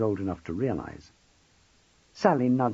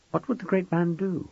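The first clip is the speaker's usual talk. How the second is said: usual speaking voice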